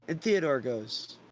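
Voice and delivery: ordinary delivery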